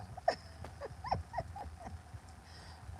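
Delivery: high airy laughter; breathy laugh. Real, not acted. [name] laughing about the mirror threat. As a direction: laugh at your own punchline.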